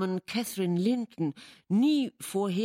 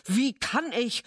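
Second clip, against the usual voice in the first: higher, louder terrified question; horrified; 6 semitones higher, 5 dB louder than usual. A narrator's reading. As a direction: gasp it out in horror. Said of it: "fast wahnsinnig vor Entsetzen".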